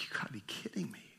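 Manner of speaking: stunned, disbelieving whisper, quick. Retold or imitated. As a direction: breathe it out barely voiced, in disbelief. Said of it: "And he was like".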